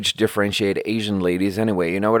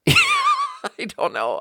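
high squeaky laughing I don't know; high-pitched flustered giggle; much slower than usual. Real, not acted. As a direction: laugh it out, embarrassed.

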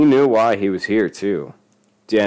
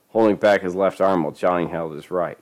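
ordinary delivery, nothing marked

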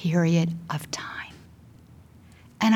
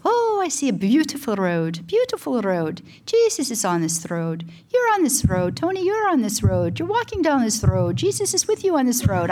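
put-on lilting, higher-pitched Irish accent; cute Irish accent; 5 semitones higher than usual. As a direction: imitate a sweet Irish nun's lilt. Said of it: "she said in her cute little Irish accent".

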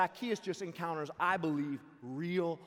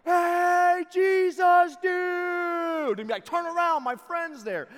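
loud high calling out; yelling across the street; 14 semitones higher, 17 dB louder, slower than usual. Retold or imitated. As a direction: shout across the street to get his attention. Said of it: "he would yell".

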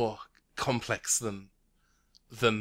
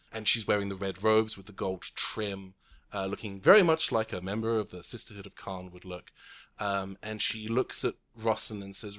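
ordinary talk

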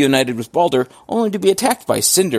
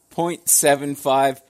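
normal talking voice

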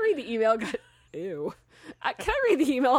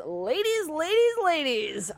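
drawn-out greeting; 6 semitones higher, much slower than usual. Retold or imitated. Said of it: "I can only ever hear that in the Jay".

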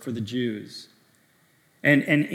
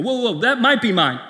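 flustered backpedal; 6 semitones higher, 5 dB louder than usual. Retold or imitated. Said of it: "and I go".

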